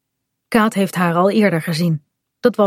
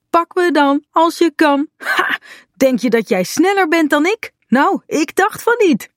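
teasing taunt; 9 semitones higher than usual. Acted. Bink teasing while running away.

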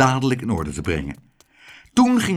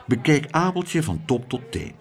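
usual speaking voice

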